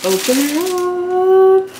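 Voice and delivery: slow, high, thrilled unboxing voice; excited sing-song. Real, not acted. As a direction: draw it out like a big reveal. Unboxing the shoes.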